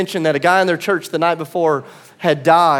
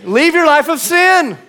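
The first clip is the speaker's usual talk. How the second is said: higher and louder, ringing out; soaring preached command; 8 semitones higher, 4 dB louder, slower than usual. Acted. Preacher rallying church with Jesus' words, ringing command. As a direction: let it ring to the whole church. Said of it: "come on, church".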